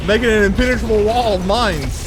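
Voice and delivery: excited shout. Real, not acted. Defending his mine spam.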